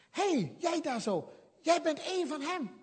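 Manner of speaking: accusing bystander. Retold or imitated. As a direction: call him out across the fire. Bystander accuses Peter by fire.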